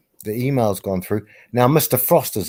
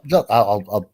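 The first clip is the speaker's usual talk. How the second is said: ordinary talk, nothing marked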